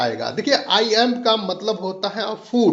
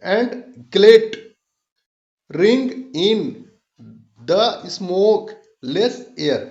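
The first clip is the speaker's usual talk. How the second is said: slow foot-by-foot reading with gaps, every second syllable drawn out; scanned iambic reading; much slower than usual. Shown as a demonstration. Teacher showing bold syllables in an example line, stretched stresses. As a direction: chop the verse into pairs, stretching each stressed syllable as in "the FIELDS", "all BRIGHT". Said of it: "उसको खींच करके हम पढ़ रहे हैं".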